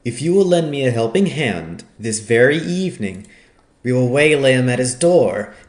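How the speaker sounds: heated outburst, throwing down a challenge; crying out. A narrator's reading. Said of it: "He cried out".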